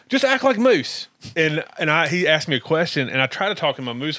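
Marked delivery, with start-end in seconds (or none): 0.1-1.1 higher-pitched imitated instruction; acting out Spud. Spud coaching the stand-in guest, high imitated voice. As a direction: put on his voice, pitched well up. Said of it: "And he goes".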